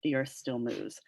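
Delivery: low, softened retold mutter; muttered under breath. Galileo forced to recant before the Inquisition, muttering quietly. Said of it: "he mutters under his breath".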